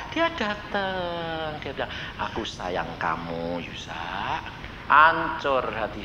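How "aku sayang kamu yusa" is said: tender Jesus voice. Retold or imitated. Jesus stroking the preacher's head, low tender murmur. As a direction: speak like a gentle Lord comforting a sinner.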